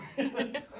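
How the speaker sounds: sudden high loud laugh; burst of laughter. Real, not acted. Teacher bursts out laughing at own pronunciation, loud laugh. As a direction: crack up at yourself. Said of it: "I sound so silly".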